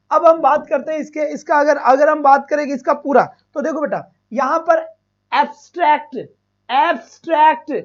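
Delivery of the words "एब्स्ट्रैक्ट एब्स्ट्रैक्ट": एब्स्ट्रैक्ट with a long stretched ए; teacher modelling the long ए; long drawn vowel. Shown as a demonstration. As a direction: hold the opening ए long in एब्स्ट्रैक्ट. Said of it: "एक ही sound आ रही है लंबी खींचने वाली".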